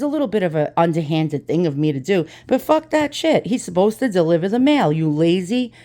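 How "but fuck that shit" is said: a defiant burst, higher pitch; defiant outburst. Real, not acted. Vlogger shrugging off his lie to the post office, in a defiant burst. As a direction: shrug off the guilt with a jab.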